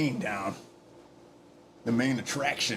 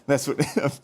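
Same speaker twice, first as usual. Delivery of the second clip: ordinary talk, nothing marked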